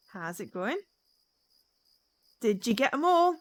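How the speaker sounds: normal talking voice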